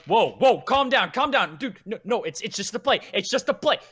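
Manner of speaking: soothing guardsmen. Acted. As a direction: talk down an armed adventurer. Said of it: "guardsmen inside the town going".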